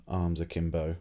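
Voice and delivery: ordinary delivery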